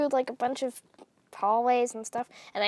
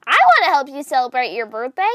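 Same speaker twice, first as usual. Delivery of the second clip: loud eager volunteering; Junebug; 8 dB louder than usual. Acted. Junebug offering to help Moth celebrate, eager and loud. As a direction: jump in loudly and eagerly. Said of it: "That was also Junebug".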